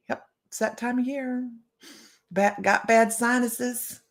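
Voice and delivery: usual speaking voice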